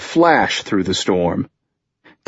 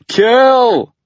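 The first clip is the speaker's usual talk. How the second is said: very loud, high sustained yell; full-breath holler; 7 dB louder than usual. A narrator's reading. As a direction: holler it with all your breath. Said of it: "Tucker shouted again".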